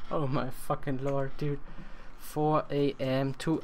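ordinary talk, nothing marked

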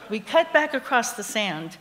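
ordinary delivery

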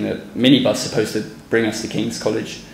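ordinary delivery

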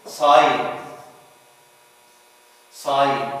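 sign, long i running into n; silent G. Shown as a demonstration. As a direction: sign as "sine", no g. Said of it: "We don't pronounce it ga".

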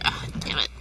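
hushed frustrated whisper. Real, not acted. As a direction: breathe it out under your breath. Muttering at game while playing.

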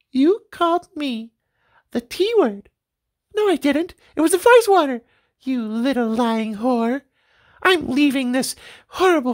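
high, offended housewife voices; wives quarreling. Acted. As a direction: take offence and storm out. Wives quarrel after insult.

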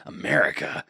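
low gruff puzzled repeat; Snake voice. Pretend. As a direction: growl it like Snake. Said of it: "I have a good time doing Snake voice".

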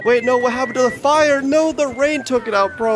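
high, drawn-out whine of dismay; dismayed wail. Real, not acted. Gamer mourning a rained-out fire, dismayed wail. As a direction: lament it, stretching the last words out high.

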